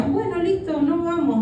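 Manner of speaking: high placating agreement to a husband; placating give-in. Retold or imitated. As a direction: give in fast so he won't get angry.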